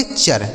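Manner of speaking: चर said low and soft; answer shown. Shown as a demonstration. Teacher answering own question, चर low and soft. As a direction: drop the voice on चर. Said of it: "क्या पढ़ेंगे?".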